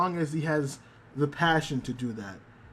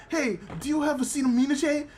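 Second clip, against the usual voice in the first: high dog voice botching acetaminophen; dog mangling the word; 10 semitones higher, faster than usual. Retold or imitated. Muchan begging for medicine for Corey, high and mispronouncing. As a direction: pitch up and stumble over the medicine name like a puppy. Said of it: "and he's like".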